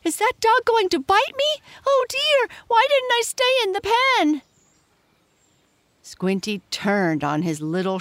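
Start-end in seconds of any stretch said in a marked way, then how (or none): 0.0-4.4 loud, very high piglet wail; high-pitched panicky pig voice; squealing pig. A narrator's reading. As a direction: squeal in fright as a little pig. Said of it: "squealed Squinty".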